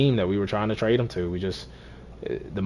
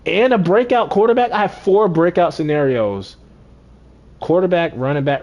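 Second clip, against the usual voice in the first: excited; 8 semitones higher, 6 dB louder than usual. Real, not acted. Excited about four breakouts.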